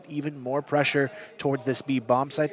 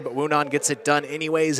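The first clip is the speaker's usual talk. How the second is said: ordinary talk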